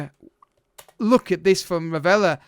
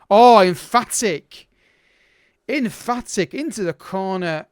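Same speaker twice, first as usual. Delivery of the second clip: loud, drawn-out cry of delight; real burst of excitement at a goal; goal celebration; 3 semitones higher, 6 dB louder, slower than usual. Real, not acted. Celebrates the penalty going in.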